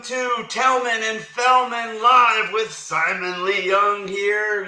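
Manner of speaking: big announcer voice, slow; show host intro. Acted. Opening a live show.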